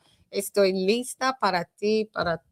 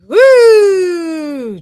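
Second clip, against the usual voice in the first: high loud woo; woo yell; 11 semitones higher, 12 dB louder than usual. Real, not acted. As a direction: let out a big whoop. Exclaims after listing heritage.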